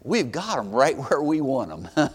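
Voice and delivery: very low, hushed gloating with a chuckle; low, soft acted satisfaction; quietly confident. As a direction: drop right down, softly savoring the advantage. Servant's acted relief, voice drops low.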